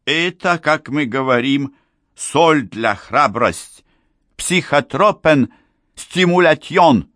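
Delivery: high, slow, careful foreign speaker; halting high-voiced old foreigner; old foreigner explaining. A narrator's reading. Foreigner Helmut explains in broken Russian.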